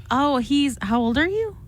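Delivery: usual speaking voice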